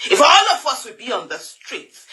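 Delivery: ordinary talk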